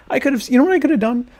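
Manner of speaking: fast, high, worked up; excited. Real, not acted. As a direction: rush it out excitedly. Excited rush of realization.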